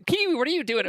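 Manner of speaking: high alarmed cry. Acted. Alarmed character outburst.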